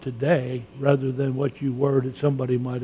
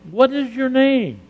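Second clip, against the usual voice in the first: higher, louder question from God; voicing God's question; 10 semitones higher, 3 dB louder than usual. Preacher voicing God asking Jacob his name, raised and probing. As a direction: ask it as God would, gently probing.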